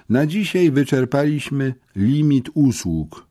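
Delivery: ordinary delivery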